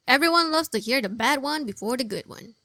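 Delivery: normal talking voice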